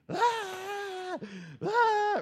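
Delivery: put-on high, drawn-out wail of a child; imitated toddler scream. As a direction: scream like a toddler who sees dad. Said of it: "She just starts screaming".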